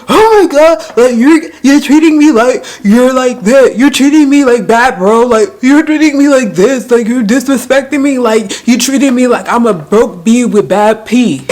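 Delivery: high, loud, hammed-up wailing complaint; mock crying rant. Girlfriend accusing her man of disrespect, mock crying. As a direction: imitate a sobbing girlfriend going on and on. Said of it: "like, crying".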